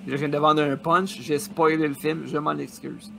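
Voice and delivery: usual speaking voice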